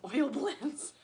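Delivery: high laughing voice, giving up on the thought. Real, not acted. Vlogger laughing at her own rambling to viewers, laughing. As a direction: let real laughter lift the words up high.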